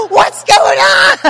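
high, very loud, drawn-out shriek; screamed demand. Retold or imitated. Mother lost in the bedlam, hysterical screaming. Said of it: "And I'm screaming".

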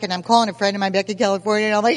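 ordinary talk

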